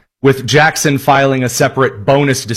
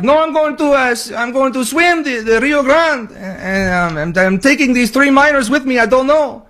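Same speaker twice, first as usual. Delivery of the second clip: high, put-on voice of a clueless migrant, much higher; mock migrant voice; 9 semitones higher than usual. Host mocking migrants at the border, high silly voice. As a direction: squeak it out like a dopey rule-breaker. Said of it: "people are like".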